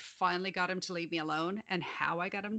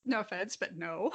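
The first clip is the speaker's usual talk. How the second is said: ordinary talk, nothing marked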